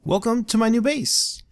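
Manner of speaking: excited welcome. Acted. Greeting viewers to her base.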